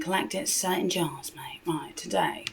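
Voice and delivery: whispered joke aside; whispered cheeky aside. Real, not acted. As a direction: drop to a conspiratorial whisper.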